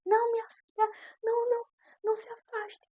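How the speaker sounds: high, tearful mother-figure begging her not to leave; pleading pastor. Retold or imitated. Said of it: "aí ela começou a chorar". (pastora chorando, implorando que a filha não se afaste)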